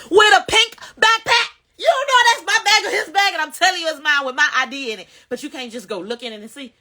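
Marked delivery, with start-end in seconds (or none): 0.1-5.1 high, loud disbelief; venting outrage at the police, voice up; indignant. Real, not acted. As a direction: scoff at the excuse.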